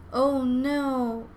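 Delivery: understated storybook shock; acted mother's alarm, drawn out; dismayed gasp. A narrator's reading. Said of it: "Ava's mother gasped".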